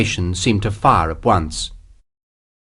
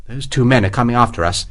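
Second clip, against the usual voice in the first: plain reading voice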